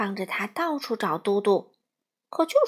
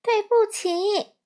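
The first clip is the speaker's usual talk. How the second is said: put-on little girl; 10 semitones higher, 5 dB louder, slower than usual. A narrator's reading. Peipei answers wrongly.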